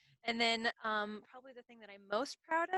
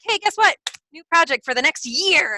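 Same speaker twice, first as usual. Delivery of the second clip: excited announcement; 6 semitones higher, 19 dB louder than usual. Retold or imitated. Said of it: "they're like".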